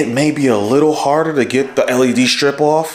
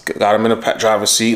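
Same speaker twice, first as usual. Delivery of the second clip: usual speaking voice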